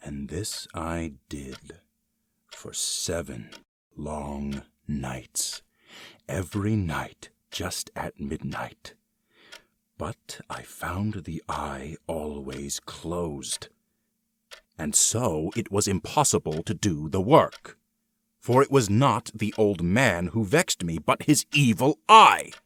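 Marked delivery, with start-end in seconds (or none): 0.1-13.7 much softer, slow and drawn out; hushed and slow. Acted. Recounting nightly creeping. 18.4-22.5 building louder and higher to a cry; rising fury. Acted. Rage at the eye.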